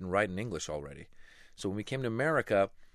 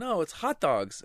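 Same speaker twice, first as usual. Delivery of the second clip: insisting classmates; 10 semitones higher, 5 dB louder, slower than usual. Retold or imitated.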